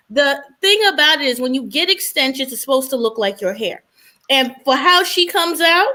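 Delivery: ordinary talk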